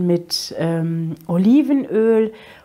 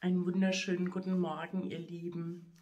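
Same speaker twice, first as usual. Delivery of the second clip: much softer, quick hushed greeting; hushed early morning; 15 dB quieter than usual. Real, not acted. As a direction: greet softly so nobody wakes. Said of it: "Ich hoffe, man hört mich".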